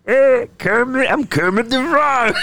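high, slow froggy voice with a shout; Kermit the Frog. Acted. Asked how Kermit sounds, he does the voice. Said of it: "how does that sound?".

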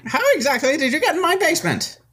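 put-on high indignant old man; old wizard voice. Wizard answers the party's insult, high indignant old man. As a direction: squeak back at the insult.

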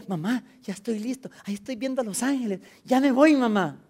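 mucho más agudo, ilusionado; anúncialo alto como un niño contento; niño que ve ángeles. Retold or imitated. (niño moribundo a su mamá, agudo y feliz)

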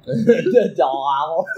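laughing through the name; drawn out, slow, laughing; The Dalai Lama said through laughter. Real, not acted. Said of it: "It's Dalai Lama".

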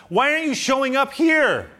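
high, loud, pleading protest; exasperated complaint. Retold or imitated. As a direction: cry out at God in frustration. Paul complaining to God.

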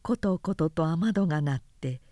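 usual speaking voice

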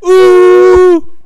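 very high, loud, slow squeal; shrieking ooh. Retold or imitated. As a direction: belt it like a rock singer. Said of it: "who else says".

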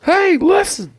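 very high fairy squeal; Navi. Retold or imitated. Imitating Navi's two-word career, squeaky fairy voice. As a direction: pipe it up like a tiny game fairy. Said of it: "Your whole career's two words".

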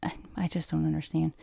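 baffled whisper. Real, not acted. Host baffled by the witnesses, whispering. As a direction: whisper it in disbelief.

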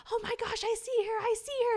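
high, loud mock panic; mock screaming girls. Sisters screaming in the dark bathroom at the mirror, high mock panic. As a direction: squeal it like scared girls seeing a ghost. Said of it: "And they're all screaming".

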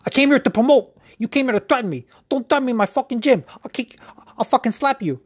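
high, heated imitation of a fighter squaring up; mocking Paulie. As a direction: mimic him threatening in his own gym. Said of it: "He's like".

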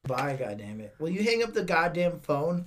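loud exasperated shout. Real, not acted. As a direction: yell at them to hang up. Hang-up argument.

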